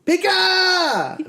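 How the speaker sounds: put-on very high, loud toddler yell; imitated child's shouting. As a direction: holler slowly at the screen like a small kid. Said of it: "he just started talking to the movie".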